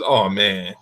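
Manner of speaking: ordinary talk, nothing marked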